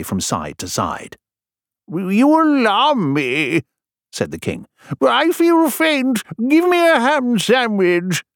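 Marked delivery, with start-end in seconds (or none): 1.9-3.6 high, drawn-out cry of alarm; slow, high fright in a storybook voice; alarmed king. Acted. King frightened by messenger's faces. 5.0-8.2 high, slow wail; hammed-up swooning king; faint, alarmed king. King overcome, begging for food.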